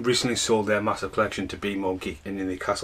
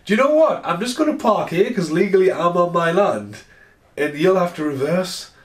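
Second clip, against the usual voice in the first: high, loud, defiant comeback; defiant retort; 7 semitones higher, 3 dB louder than usual. Retold or imitated. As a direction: put them in their place. Heated parking quarrel.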